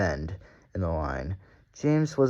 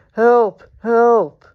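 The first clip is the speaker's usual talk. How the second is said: much higher, loud desperate cry; crying for help; 14 semitones higher, 12 dB louder than usual. A narrator's reading. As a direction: scream for help as you run.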